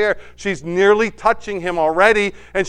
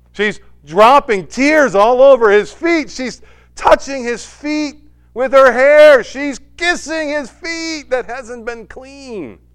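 loud, slow, higher preaching swell; preaching build; 8 semitones higher, 9 dB louder, slower than usual. Acted. Pastor painting the weeping woman for the congregation, impassioned. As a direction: lean into every word of the scene.

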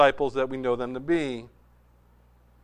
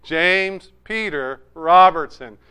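slow, loud, drawn-out James, Peter, Robertson; savoring each name; 6 dB louder, slower than usual. Shown as a demonstration. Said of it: "One syllable, two syllable, three syllable name".

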